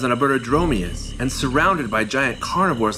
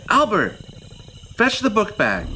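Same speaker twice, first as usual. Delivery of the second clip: higher, coaxing call to a pet; 8 semitones higher than usual. Real, not acted. Calling Albert over to fetch the bag, high and coaxing. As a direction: call him like you'd call a puppy. Commanding the little dinosaur to fetch.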